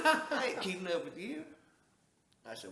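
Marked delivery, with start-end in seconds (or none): 0.0-1.4 laughing retold refusal.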